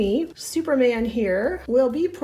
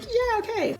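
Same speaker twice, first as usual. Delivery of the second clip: bored yeah okay; 10 semitones higher than usual. Acted. Bored brother-in-law brushes off.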